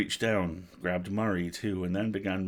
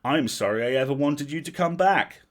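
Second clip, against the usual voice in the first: loud, hurt outburst rising in pitch; angry yell; 7 semitones higher, 7 dB louder than usual. A narrator's reading. Girl renouncing her brother, yelling angrily. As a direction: yell it, building higher and louder to the end. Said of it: "yelled the girl".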